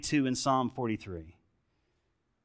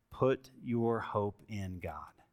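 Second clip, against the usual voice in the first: slow quiet reassurance; 5 semitones lower, 8 dB quieter, much slower than usual. Retold or imitated.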